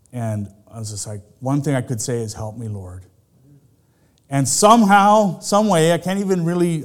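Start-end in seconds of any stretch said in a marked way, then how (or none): 2.3-3.0 faint desperate murmur. Retold or imitated. Near drowning memory, faint.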